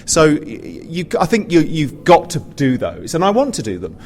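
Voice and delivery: ordinary delivery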